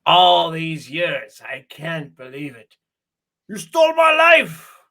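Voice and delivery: hammed-up French villain, shout at the end; Merovingian rant. Mocking the Merovingian for a friend, building to a shout. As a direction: ham up the Merovingian's outburst. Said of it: "He says".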